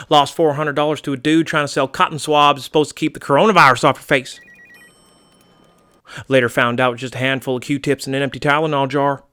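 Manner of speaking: wary, drawling American homeowner; folksy American target. Acted. American target recounting an earlier swindle, folksy and wary. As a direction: grumble like a good old boy.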